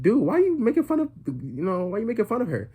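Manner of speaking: indignant mock yell. Retells yelling at his friends.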